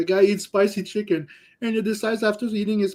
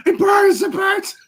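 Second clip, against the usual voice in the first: high, loud mock-agony wail; retold pained shout; 12 semitones higher, 5 dB louder than usual. Co-host imitating the man leaving the bathroom, agonized shout. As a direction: shriek it twice, imitating someone on fire inside. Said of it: "he comes out of the bathroom".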